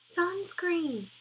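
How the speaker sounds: Sunscreen! cried out high and amazed; incredulous exclamation. Real, not acted. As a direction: say Sunscreen! as if it can't be real. Repeats the product in disbelief.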